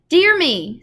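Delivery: high, loud shocked gasp of words. A narrator's reading. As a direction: recoil in shock at the penguins. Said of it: "said Missus Popper in a shocked tone".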